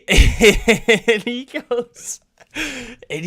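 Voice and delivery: cracking up mid-sentence; laughing. Real, not acted. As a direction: burst out laughing while trying to go on. Cracks up telling the story.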